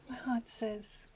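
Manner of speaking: put-on soft whispered quote of a little girl. Mum quoting her daughter Leia in a whisper. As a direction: hush it like a secret. Imitating daughter Leia's phrase.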